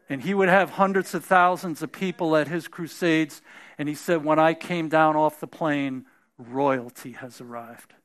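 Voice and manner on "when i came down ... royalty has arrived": low, soft grand proclamation; hushed regal boast from the old evangelist; T L Osborne impression. Preacher retells T L Osborne's arrival line, low and hushed. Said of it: "That's how he talked".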